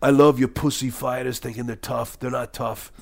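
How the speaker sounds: mock Australian henchman voice; Australian accent. Villain sneering at fighters who think they're tough, Australian accent. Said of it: "That's, of course, Australian accent".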